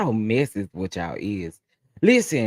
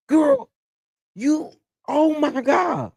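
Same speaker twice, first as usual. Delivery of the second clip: 1 shocked disbelief; 3 dB louder, slower than usual. Real, not acted. Shocked reaction to cookie.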